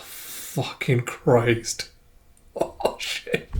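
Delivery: soft, drawn-out swearing under the breath; real quiet disbelief, slowly exhaled; stunned muttering. Real, not acted. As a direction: swear softly, nearly voiceless. Reader reacting to grim scene.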